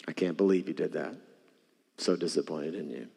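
low, quiet, cold disappointment; stern disappointed God. Shown as a demonstration. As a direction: voice a harsh scolding God, low and soft. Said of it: "like this".

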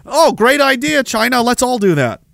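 high, sarcastic approval; mock enthusiasm. Western governments admire China's surveillance, sarcastic enthusiasm. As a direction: gush insincerely, starting high.

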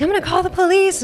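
high loud angry yell; retold scream. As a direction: shout it at the passenger. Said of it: "like, screaming".

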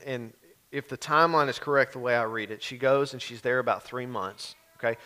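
ordinary delivery

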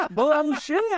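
high laughing bullshit. Real, not acted. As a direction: laugh it out in disbelief. Laughing at friend's claim.

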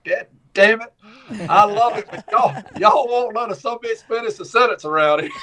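high-pitched irritated son voice, laughing at the end; high mock-grumpy son voice breaking into a laugh. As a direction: mimic a fed-up son who can't get a word in. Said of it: "He's like".

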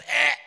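short, loud effort noise; sudden mock attack grunt; stabbing grunt. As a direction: mimic the thrust of a stab. Acts out the knife stab.

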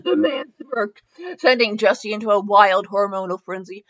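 ordinary talk, nothing marked

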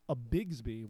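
ordinary talk, nothing marked

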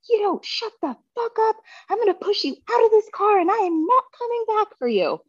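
high, strained angry threat; furious threat. Retold or imitated. Wife threatening husband in the car, high furious threat.

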